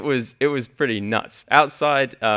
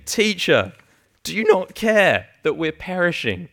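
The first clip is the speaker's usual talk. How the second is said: pleading disciples; 7 semitones higher, 3 dB louder than usual. Retold or imitated. Disciples wake Jesus in storm.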